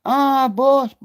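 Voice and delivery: high mock yell of a panicked man; a hammed-up high-pitched cry for help; retold scream. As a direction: imitate him yelling for the boss, high and sharp. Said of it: "and then he started screaming".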